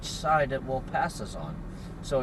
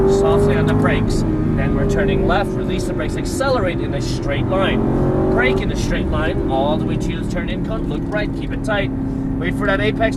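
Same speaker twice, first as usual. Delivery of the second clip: loud, urgent coaching calls; shouting over engine noise; 4 semitones higher, 12 dB louder than usual. Real, not acted. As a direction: call instructions over a roaring engine.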